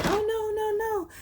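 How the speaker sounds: put-on high frantic old lady voice, way up in pitch; high-pitched begging granny; grandma pleading. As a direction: squeal it like a panicked granny losing her freezer. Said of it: "and Granny was like".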